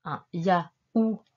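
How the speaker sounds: YAOURT in pieces, ya - ou - rte; syllable breakdown. Shown as a demonstration. Teacher repeats for the writer, YAOURT syllable by syllable. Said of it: "tu écris".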